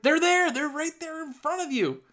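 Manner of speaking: high, urgent protest; incredulous outburst, voice jumping high; exasperated insistence. Acted. Exasperated answer to the excuses.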